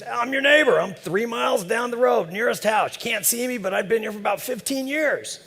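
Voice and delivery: high, hearty backwoods voice; folksy old neighbor. Retold or imitated. Old neighbor introduces himself.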